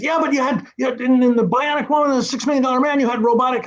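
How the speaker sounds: high, fast excited impression of Stan; imitating Stan. Telling the writer about robots, high and fast. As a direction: squeak it out quickly like an eager old producer. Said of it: "and he said".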